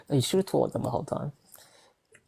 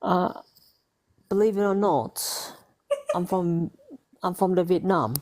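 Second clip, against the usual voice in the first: put-on higher-pitched, botched English accent; fobby English accent; 5 semitones higher than usual. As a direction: attempt English accent, slipping into fob. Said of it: "I sounded fob just then with an English accent".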